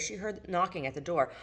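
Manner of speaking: usual speaking voice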